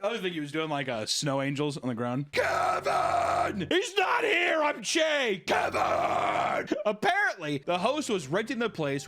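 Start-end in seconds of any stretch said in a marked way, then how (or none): 2.3-6.8 shouted, pitched way up; mock yelling intruder. Intruder yelling at the startled couple, mock shouting. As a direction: yell it like a crazed intruder bursting in.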